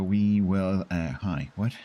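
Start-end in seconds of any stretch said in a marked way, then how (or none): none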